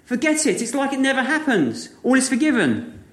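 a hint of a merciful king; king forgiving.

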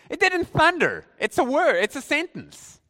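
high, insistent objection; exasperated, high-pitched correction; incredulous protest. Real, not acted. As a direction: pitch it high, as if it's absurdly obvious. Protests the thunder explanation.

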